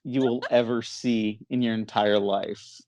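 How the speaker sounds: normal talking voice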